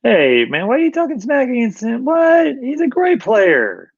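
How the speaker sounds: high-pitched, drawn-out playful protest; hammed-up offended fan, voice shooting way up; teasing mock outrage. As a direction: squeal in pretend indignation to rib a friend. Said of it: "Just giving you a hard time".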